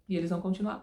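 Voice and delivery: ordinary talk, nothing marked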